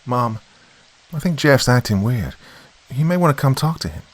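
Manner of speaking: put-on high-pitched boy's voice; worried son. A narrator's reading. Son calls to mother through the bedroom door, high and worried.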